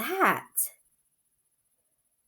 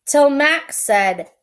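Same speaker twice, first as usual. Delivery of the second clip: story reading; 4 semitones higher, 6 dB louder than usual. A narrator's reading. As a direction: read it like a bedtime story. Reads picture book aloud.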